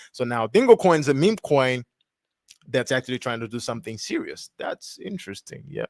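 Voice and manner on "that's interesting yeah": voiceless murmur to himself; soft unvoiced aside, much softer; hushed aside. Real, not acted. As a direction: whisper it under your breath. Muttered reaction while reading.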